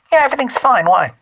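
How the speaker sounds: high-pitched mock mother; mom's affected voice. Host mimics his mother on the phone, affected high voice. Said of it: "sounded like Linda from Bob's Burger".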